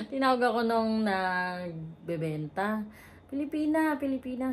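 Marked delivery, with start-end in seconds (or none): 3.3-4.5 vendor calling out. Retold or imitated. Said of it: "sabi niya".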